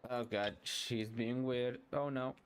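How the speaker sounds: ordinary delivery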